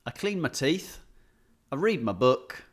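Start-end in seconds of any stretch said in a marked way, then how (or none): none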